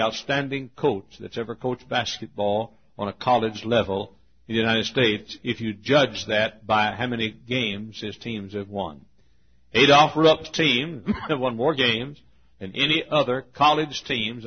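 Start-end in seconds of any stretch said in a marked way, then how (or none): none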